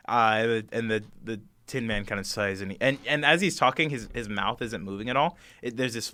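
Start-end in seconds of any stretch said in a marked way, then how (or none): none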